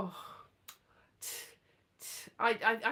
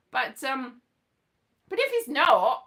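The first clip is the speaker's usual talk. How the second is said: ordinary talk, nothing marked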